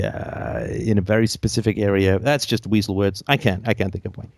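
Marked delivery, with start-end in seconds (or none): none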